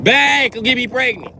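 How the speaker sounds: put-on high, loud, pleading girlfriend voice; mock needy girlfriend. Woman begging man for baby.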